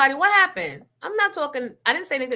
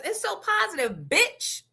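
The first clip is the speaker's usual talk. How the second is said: high sarcastic mimicry; mocking sneer; 8 semitones higher, 3 dB quieter than usual. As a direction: throw her words back at her, pitched up. Mocking rival's catchphrase.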